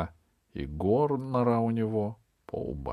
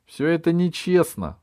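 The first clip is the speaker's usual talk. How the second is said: high, loud, upset protest; indignant girl's raised-voice outcry; angry, near tears; 11 semitones higher, 8 dB louder than usual. A narrator's reading. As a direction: protest the injustice while holding back angry tears. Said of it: "стараясь не заплакать от злости".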